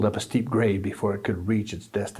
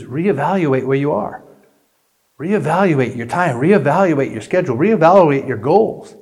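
building louder with each reevaluate; preaching; 4 semitones higher, 7 dB louder than usual. Acted. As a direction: preach it rising in force. Building sermon repetition.

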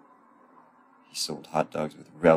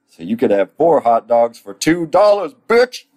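loud, high, punched sales pitch; brash shout; 6 semitones higher, 8 dB louder than usual. Acted. Stan tells the family the deal, brash shouting. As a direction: build to a high shout on the last word.

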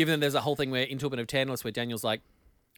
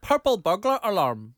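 slow, slightly louder Scottish "purple burglar alarm"; Scottish accent; 4 semitones higher, 5 dB louder than usual. Shown as a demonstration. Second try for friend, slow Scottish accent. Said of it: "it wasn't a Scottish accent at the end".

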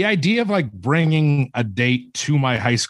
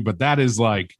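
ordinary talk, nothing marked

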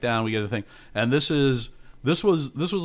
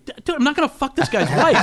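high, loud, exasperated outburst; laughing, raised-voice mock protest; indignant protest; 6 dB louder than usual. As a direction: blurt it out in comic disbelief. Retelling indignant reply to friend.

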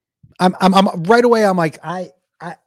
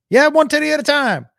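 higher, louder pitchman voice for the doctor; high, loud hammed-up doctor; mock doctor; 11 semitones higher, 4 dB louder than usual. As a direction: sell it like a cheesy surgeon.

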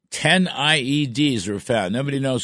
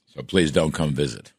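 ordinary talk

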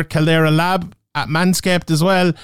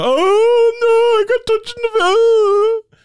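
high, whiny fake crying, much higher; mock Harry Kane; much slower than usual. Mocking Harry Kane moaning about being touched, in high fake sobs. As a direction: whimper it like a footballer faking injury.

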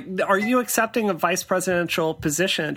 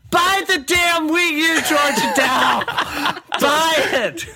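higher, louder playful demand with laughter; mock yell; 9 semitones higher, 4 dB louder, slower than usual. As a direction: shout it at her, laughing. Yelling demand at Georgia.

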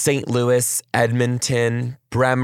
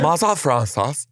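put-on German accent; 5 semitones higher than usual. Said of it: "Now I sound like the German guy".

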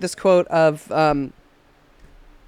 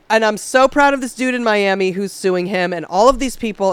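ordinary talk

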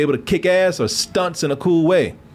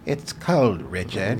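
put-on old woman voice; 6 semitones lower, 5 dB quieter, much slower than usual. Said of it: "Sound like an old woman with Parkinson's".

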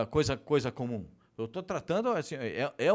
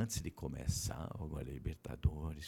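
low hushed secret-sharing voice; leaning in to whisper; 13 semitones lower, 8 dB quieter than usual. Real, not acted. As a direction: drop the voice like telling gossip. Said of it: "quando eu vou falar baixinho".

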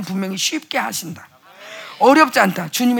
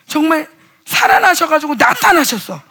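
normal talking voice

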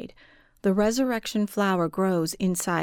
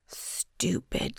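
whispered self-scolding; 6 dB quieter than usual. A narrator's reading. Narrator scolds herself in thought.

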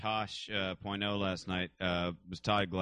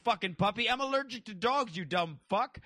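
mock yelling; 11 semitones higher than usual. Prankster berates kid for getting in car, mock yelling. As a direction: imitate an outraged scolding, pitched way up. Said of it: "yelling at the kid for getting in the car".

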